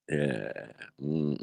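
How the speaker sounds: normal talking voice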